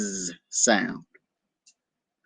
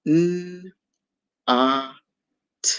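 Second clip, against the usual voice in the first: sounded out; 5 semitones higher, 3 dB quieter, slower than usual. Shown as a demonstration. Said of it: "blend our letters together".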